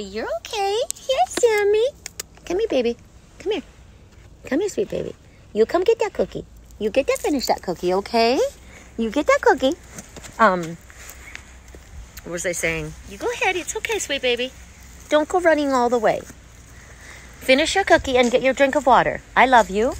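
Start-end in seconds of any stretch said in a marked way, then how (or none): 0.0-9.7 sing-song pet talk, much higher. Real, not acted. Talking sweetly to her dog. 13.1-19.9 sing-song pet talk, much higher. Real, not acted. Coaxing the dog to eat.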